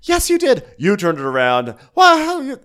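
high, loud accusing squeal; hammed-up shrieking blame; mock shrill accusation. Garcelle accusing Rinna, high mock shriek. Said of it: "She goes".

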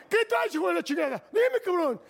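high mock shout of a furious thug; hammed-up road-rage shout; imitating a screaming fighter. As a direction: yell it up high like the angry driver. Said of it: "Me gritaba aquí".

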